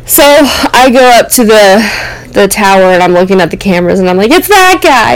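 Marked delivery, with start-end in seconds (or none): 4.3-5.1 excited recognition. Retold or imitated. Spotting the thief on camera.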